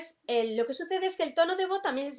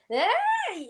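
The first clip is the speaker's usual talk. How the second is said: high-pitched yelp; 12 semitones higher, 5 dB louder than usual. Shown as a demonstration. Pitch jumps to show excitement.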